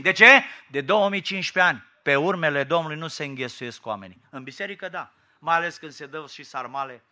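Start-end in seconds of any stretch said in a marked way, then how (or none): none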